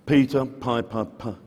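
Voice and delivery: ordinary talk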